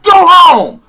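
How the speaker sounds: loud, high barked order to leave; furious shout. Retold or imitated. Kurt Angle ordering Seth Rollins out, shouted. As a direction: bark it like an angry boss. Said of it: "he's like, he's like".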